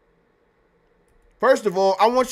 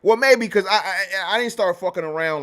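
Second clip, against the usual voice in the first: usual speaking voice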